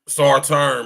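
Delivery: rallying shout; loud catchphrase. Retold or imitated. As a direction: call it out like a rallying cry.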